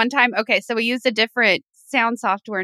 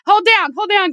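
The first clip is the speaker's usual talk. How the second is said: quoting Levy; 10 semitones higher, 5 dB louder than usual. Retold or imitated. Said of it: "that's a Levy reference".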